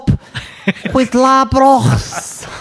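high, drawn-out mock Scouse; slow, squeaky-high Scouse send-up; Scouse impression. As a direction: put on an exaggerated Scouse accent, laughing midway. Put-on Scouse voice joke.

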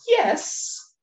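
normal talking voice

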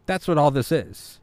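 usual speaking voice

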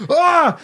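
high startled cry; startled scream. Acted. Boys scream at Lincoln behind them, high startled yelp. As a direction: yelp in fright.

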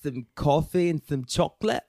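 high-pitched mock British accent; hammed-up British impression; amateur British accent. Shown as a demonstration. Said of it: "That's mine".

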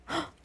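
shocked gasp. A narrator's reading. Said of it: "McGonagall gasps in shock".

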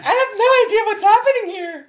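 high, laughing Lisa voice; squeaky innocent housewife voice; Lisa impression. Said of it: "she's like".

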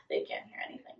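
whispering a joke so the parents don't hear. Real, not acted. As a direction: whisper it like a secret. Joke about parents present.

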